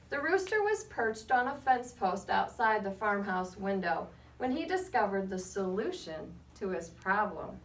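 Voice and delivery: put-on lively read-aloud to children, rising on names; story narration. A narrator's reading. Reading to the class with lively storybook narration. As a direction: tell it like a picture book to a class. Reads picture book aloud.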